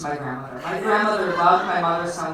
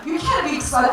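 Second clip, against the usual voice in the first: indignant; 7 semitones higher, 10 dB louder than usual. Retold or imitated. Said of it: "Like, I'm indignant".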